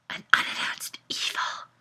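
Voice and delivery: terrified hushed whisper. Acted. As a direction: breathe it out in fear. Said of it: "whispered Mariana".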